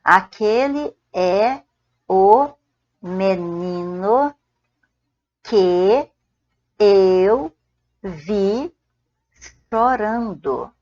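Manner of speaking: each word drawn out with pauses; slow spaced-out teacher dictation. Shown as a demonstration. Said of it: "você pode falar só assim".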